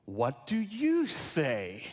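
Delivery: "What do you say" drawn out slowly, voiced; slow, pointed question. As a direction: put the question slowly and deliberately to the listeners. Book's question repeated as Jesus's question.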